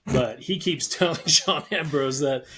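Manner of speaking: usual speaking voice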